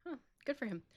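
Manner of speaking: usual speaking voice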